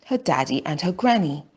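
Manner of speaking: ordinary delivery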